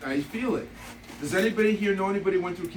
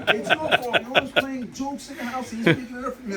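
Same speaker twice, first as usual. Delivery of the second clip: higher-pitched mock scolding through laughter; imitated angry wife; 6 semitones higher, 3 dB louder than usual. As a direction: laughingly mimic a wife's angry rant. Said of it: "Man, she was angry".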